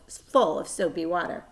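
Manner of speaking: plain reading voice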